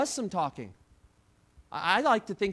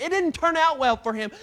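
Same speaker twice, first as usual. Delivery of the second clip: ordinary talk, nothing marked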